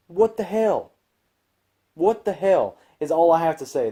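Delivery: high-pitched, incredulous outburst; real exasperated outcry; exasperated disbelief. Real, not acted. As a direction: blurt it out high, falling away in exasperation. Reacting to Goku's foolish agreement.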